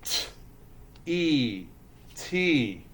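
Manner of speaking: "T" split into a t sound and long E, then blended as tee. Shown as a demonstration. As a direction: phonics drill, each sound alone. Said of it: "Roller coasters ready?".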